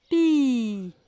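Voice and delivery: "Pi" with a long held i; "Pi" stretched to confirm its last sound; final sound stretched. Shown as a demonstration. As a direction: draw out the vowel of "Pi". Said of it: "On entend".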